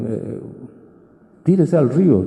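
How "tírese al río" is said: higher, louder call to jump; acted insistent order; urging command. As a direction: urge someone on, raised voice.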